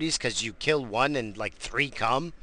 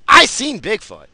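emphatic claim; 9 semitones higher, 12 dB louder than usual. Real, not acted. Repeats the claim louder.

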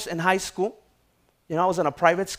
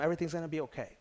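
reassuring to neighbour; 7 dB quieter, much faster than usual. Real, not acted. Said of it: "tell them".

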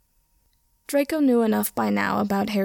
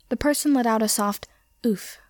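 ordinary delivery, nothing marked

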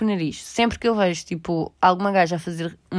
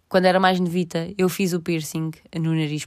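ordinary talk

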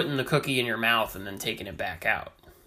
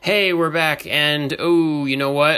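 show host voice; 3 semitones higher, 9 dB louder than usual. Pretend. Mock show host announcement.

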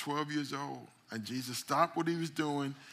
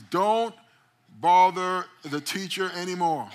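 loud and higher, blurting bad news; urgent shout; 6 semitones higher, 11 dB louder than usual. Messenger shouting at Jesus to stop bothering the teacher. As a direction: yell it like a frantic messenger.

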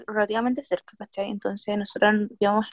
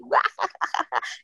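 imitated cackling laugh; 7 semitones higher, 3 dB quieter than usual. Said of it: "muerta la risa, así".

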